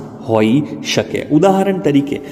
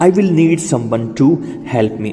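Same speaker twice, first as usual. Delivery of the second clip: slow, word by word; teacher repeats it slowly; slow model sentence; much slower than usual. Shown as a demonstration. Slow drilled sentence for learners.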